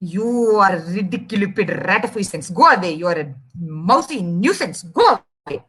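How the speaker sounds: low, irritated elephant voice; annoyed elephant. Acted. Elephant telling the mouse to go away, low and annoyed. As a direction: dismiss the pest in a low voice. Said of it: "elephant is very pissed off".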